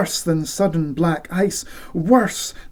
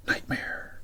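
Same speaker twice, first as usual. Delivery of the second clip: whispered; 14 dB quieter than usual. A narrator's reading. Dread word in narration.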